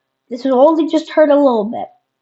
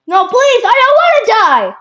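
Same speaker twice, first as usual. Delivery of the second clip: high, loud begging; pleading scream; 12 semitones higher, 8 dB louder than usual. Pretend. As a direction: beg for your life. Victim begging.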